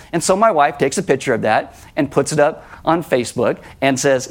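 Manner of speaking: normal talking voice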